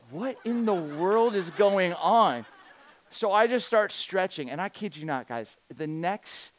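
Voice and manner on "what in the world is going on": slow, raised, loud disbelief; acted bewilderment. Visitor suddenly picked for a basketball game, bewildered. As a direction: stretch it out, baffled.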